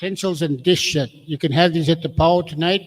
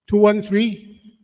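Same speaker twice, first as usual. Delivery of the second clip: calling out a number; 3 semitones higher, slower than usual. Real, not acted. Calling again, no winner.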